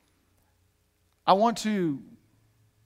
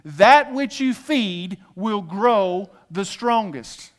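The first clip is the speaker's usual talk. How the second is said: slower call and response; much slower than usual. Shown as a demonstration. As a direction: draw out "That which you feed will grow the strongest" very slowly. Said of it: "Say this with me".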